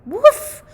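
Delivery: very high, loud puppy bark; excited woof. Acted. The puppies barking at Santa's arrival, an excited high woof.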